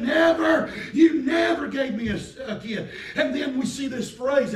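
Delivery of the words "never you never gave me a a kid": angry. Retold or imitated. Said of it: "He said".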